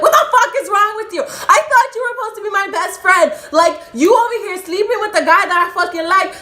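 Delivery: high, loud acted-out screaming; outraged, very high-pitched yelling; furious confrontation. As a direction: scream betrayal at your best friend. Acted confrontation with best friend.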